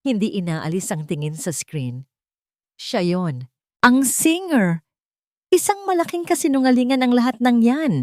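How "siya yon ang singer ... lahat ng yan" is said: loud, high, furious denial; mother lashes out at the news; shouting it all down as a lie; angry. A narrator's reading. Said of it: "galit na sabi ni Isabela".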